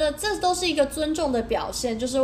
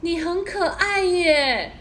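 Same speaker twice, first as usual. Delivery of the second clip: driver's compliment; 6 semitones higher, much slower than usual. Retold or imitated. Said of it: "他就跟我说".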